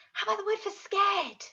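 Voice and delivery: usual speaking voice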